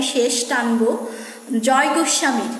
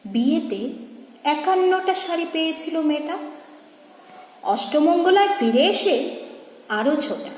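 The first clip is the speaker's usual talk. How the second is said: lilting verse, rising then dropping at phrase ends; poem recitation; 2 semitones higher than usual. Acted. Reciter telling of a bride's many saris, lilting recitation. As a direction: recite the refrain in full voice. First take of refrain.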